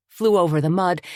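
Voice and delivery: ordinary delivery, nothing marked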